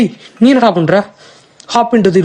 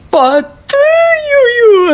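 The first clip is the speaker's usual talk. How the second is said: anguished cry; much slower than usual. Real, not acted. Game crash, gamer cries out.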